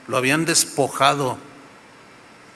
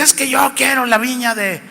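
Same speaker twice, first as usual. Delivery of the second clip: a hint of a high, sulky king's whine; whiny king; 7 semitones higher than usual. King Acab complains to his wife, lightly whining. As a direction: whine it like a spoiled child.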